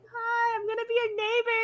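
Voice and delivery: put-on high-pitched hello to little kids; friendly greeting to kids. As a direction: greet small children in a high voice. Greets neighbour kids in retelling.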